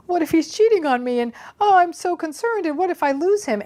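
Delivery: high, whiny mock worry; a high, hammed-up fret over a cheating boyfriend; anxious insecure partner. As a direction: play up the clingy fretting partner. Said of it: "you're like".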